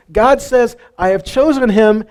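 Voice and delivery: usual speaking voice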